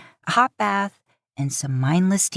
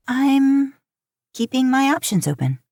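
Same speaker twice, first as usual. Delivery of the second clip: put-on higher, louder, defensive young voice; Claire's voice; 8 semitones higher, 3 dB louder than usual. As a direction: dodge the question, a bit too bright. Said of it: "Claire's eyes went to Kaya".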